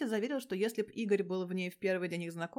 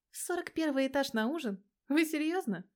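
higher, incredulous question; 6 semitones higher, 3 dB louder than usual. Acted. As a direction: she can't believe it. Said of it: "не веря своим ушам". (недоверчиво, выше обычного)

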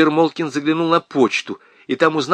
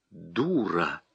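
much lower, softer, cold; quiet insult; 7 semitones lower, 10 dB quieter than usual. A narrator's reading. Husband quietly insults his wife. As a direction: say it quietly and coldly. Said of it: "сказал он тихо".

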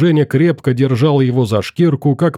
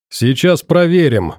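higher, brisk shout; raised-pitch announcement to the crowd; calling out; 6 semitones higher than usual. A narrator's reading. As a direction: call it out with resolve. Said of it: "выкрикнул Саша".

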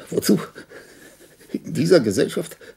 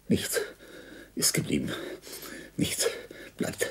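breathy whisper, almost gone; fading whisper; 13 semitones lower, 13 dB quieter than usual. Acted. Dying man whispering his despair to himself. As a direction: let the words die into a whisper.

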